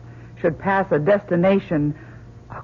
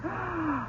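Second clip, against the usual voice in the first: shocked gasp; 11 semitones higher, 13 dB quieter than usual. Acted. Doctor hears the destination.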